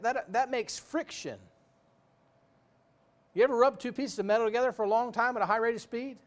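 normal talking voice

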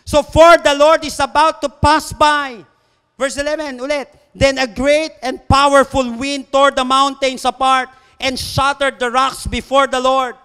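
loud, slow, high-pitched proclamation; full-force scripture preaching. Acted. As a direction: ring each phrase out to the congregation.

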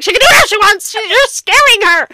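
high, loud imitation of outraged friend; mock indignant yell. Friend defending her against the officer, indignant yell. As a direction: yell back in hammed-up outrage.

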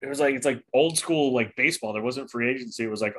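ordinary talk

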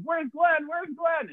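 very high, urgent repeated question; frantic asking. Retold or imitated. Runner asking every person he passes, frantic. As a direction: pester each passer-by in a high mock panic.

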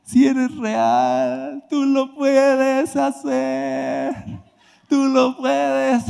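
very high, very slow weeping plea; crying out to God in the rain, high and drawn-out; tearful pleading. Retold or imitated. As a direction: beg through tears. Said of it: "Y yo ahí chillando".

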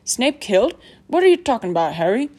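loud, lifted, swooping questions; incredulous. A narrator's reading. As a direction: demand an explanation in disbelief. Hagrid astonished by the news.

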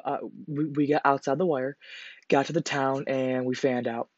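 ordinary talk

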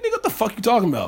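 high, fast mock disbelief; incredulous dude. Host imagines a man refusing the Vegas trip, mock incredulous. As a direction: fire back baffled and high, in a put-on voice.

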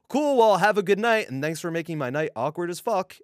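high, loud sign-off that eases off. Retold or imitated. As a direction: toss out a loud, high-pitched goodnight. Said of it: "I said that, like, out loud".

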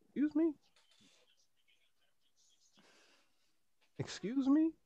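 soft, high-pitched incredulous query; offended disbelief. Retold or imitated. Answering her danger complaint, affronted and incredulous.

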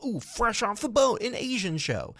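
high, slow, gleeful mock executive. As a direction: pitch it like a smug network boss. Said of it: "So now they're like".

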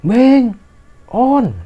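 high-pitched shouted names, one by one; retold calling out. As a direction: call for friends lost on the mountain. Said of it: "Dia teriak-teriak itu". (menirukan teriakan memanggil teman)

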